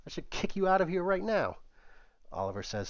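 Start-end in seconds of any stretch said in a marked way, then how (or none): 0.1-1.6 high indignant threat; outraged. Acted. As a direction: shriek of disgust. Cam outraged.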